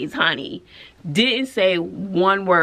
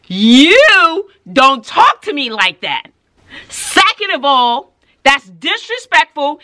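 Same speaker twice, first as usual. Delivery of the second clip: loud, slow, emphatic telling-off; stern scolding; 4 semitones higher, 8 dB louder than usual. Retold or imitated. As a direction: lay down the law loudly, point by point. Teacher scolds screaming student.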